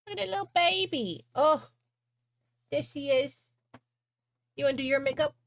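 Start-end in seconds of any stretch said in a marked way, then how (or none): none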